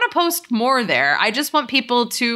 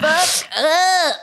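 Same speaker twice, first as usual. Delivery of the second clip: higher, laughing crude sign-off; playful crude pitch; 7 semitones higher than usual. Pretend. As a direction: blurt it out cheekily. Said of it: "Yeah, I'll talk like that".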